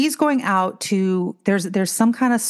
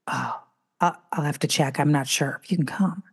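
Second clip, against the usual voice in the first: lower, softer hesitant mumble; mock hesitant husband; 4 semitones lower, 4 dB quieter than usual. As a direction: imitate him stalling, low and quiet. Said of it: "he goes".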